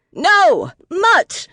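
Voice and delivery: high, loud terrified command to a dog; urgent full-voiced yell to halt the dog; roar. A narrator's reading. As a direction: roar it out sharply, pitch leaping up. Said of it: "Kate roared".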